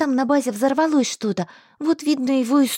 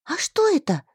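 surprised; 7 semitones higher than usual. Acted. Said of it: "удивилась я".

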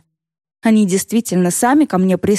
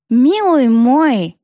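slow, mumbling granny voice, lip tucked in; toothless old woman; 6 semitones higher, 3 dB louder, much slower than usual. Shown as a demonstration. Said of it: "Вот так".

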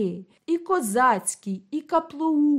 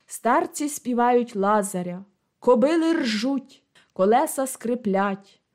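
ordinary delivery

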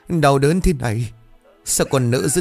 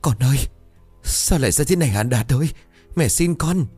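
grieving wail; 7 semitones higher than usual. A narrator's reading. Mother cutting her son down, wailing in grief. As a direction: beg him as if he could still hear.

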